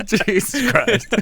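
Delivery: high, slow words through laughter; laughing. Real, not acted. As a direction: laugh out the disbelief. Laughing retelling the storm.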